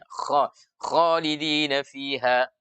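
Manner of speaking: "khalidina fiha" with heavy kh and dark vowel; tebal, benar. Shown as a demonstration. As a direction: recite "khalidina fiha" slowly and thickly. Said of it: "Harus khalidina". ("khalidina fiha" dibaca tebal perlahan)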